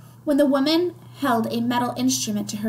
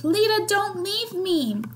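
mock scream; 9 semitones higher than usual. A narrator's reading. Larissa to Lida as they are parted, high restrained scream. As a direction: cry out high, as a narrator voicing a child's scream. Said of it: "Larissa screamed".

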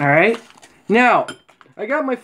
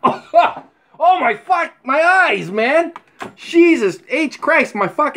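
laughing in pain; 7 semitones higher, slower than usual. Real, not acted. Whiskey spray stung his eyes.

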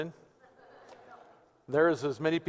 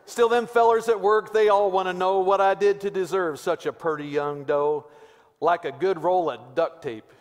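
put-on Pastor Weaver's Texas accent; 4 dB louder than usual. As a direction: woo her with mock-poetic Texas talk. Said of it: "This is in my best Pastor Weaver, Texas accent voice".

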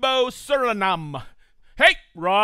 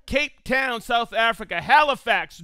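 usual speaking voice

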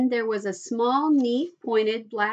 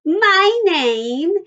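loud, high, drawn-out announcement; high child's voice, proclaiming; self-important child; 7 semitones higher, 10 dB louder than usual. A narrator's reading. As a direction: announce yourself at full volume. Child announces herself grandly.

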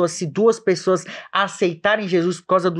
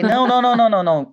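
louder, higher string of não; insistent no; 7 semitones higher, 6 dB louder, much faster than usual. Retold or imitated. Retelling how he pushed his cousin, loud insistent não. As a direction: cut him off, no no no.

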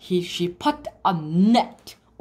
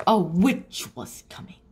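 usual speaking voice

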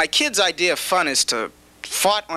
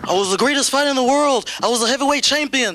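Muhammad Ali; 8 semitones higher than usual. Retold or imitated. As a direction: brag like the greatest boxer alive. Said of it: "like Muhammad Ali".

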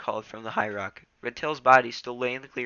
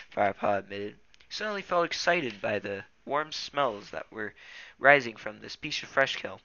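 plain reading voice

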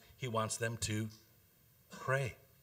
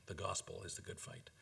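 whisper; 4 semitones lower, 12 dB quieter than usual. Real, not acted. Preacher whispering the point to his congregation. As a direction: whisper the key line low and intimate.

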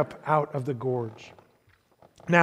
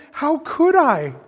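Lucy pleading harder; 11 semitones higher, 5 dB louder than usual. A narrator's reading. Second take, slower and louder.

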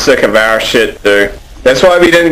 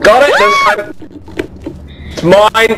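very high squeaky voice; 10 semitones higher than usual. Pretend. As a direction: squeak it out triumphantly.

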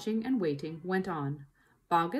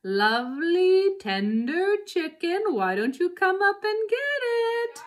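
taunting; 10 semitones higher, 9 dB louder than usual. Acted. Farmer taunting the starving fox.

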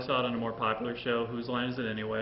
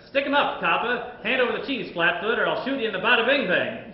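loud, high tough-guy robber voice; gangster robber; 7 semitones higher, 5 dB louder than usual. Acted. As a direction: growl it like an old-time stickup man. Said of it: "I'm going to be a robber".

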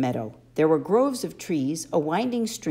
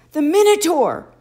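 The Minotaur! shouted much higher and louder; shocked exclamation; 11 semitones higher, 10 dB louder than usual. A narrator's reading. Boy recognizes the bloody horn, loud alarmed cry. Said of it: "I said".

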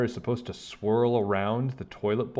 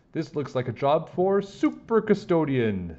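higher, slower superhero proclamation; heroic custodian; 6 semitones higher than usual. Acted. Custodian taking charge of the flood, grand heroic slogan. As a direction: announce the mission grandly. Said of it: "said Miss Lazar".

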